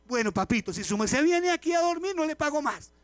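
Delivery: put-on loud, high half-joking scold of a sleepy child; mock scolding. Parent scolding child who sleeps at ballet class, loud mock scold. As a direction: tell the kid off like a stern parent. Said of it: "así lo puede regañar".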